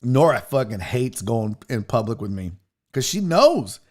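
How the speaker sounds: normal talking voice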